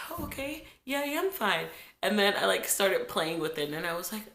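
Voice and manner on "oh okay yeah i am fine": put-on little girl.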